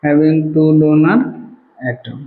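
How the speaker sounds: drawn out slowly, a final repeat; slow dictation. Shown as a demonstration. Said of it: "repeats dictation".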